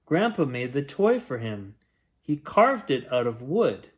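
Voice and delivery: plain reading voice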